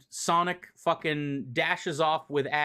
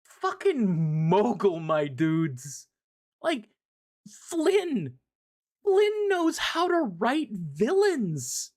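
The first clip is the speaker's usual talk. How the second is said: much higher, slow, awed raving; squealing high praise; excited gushing; 9 semitones higher, much slower than usual. Real, not acted. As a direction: rave like a fan blown away by the villain. Fan gushing about the writer.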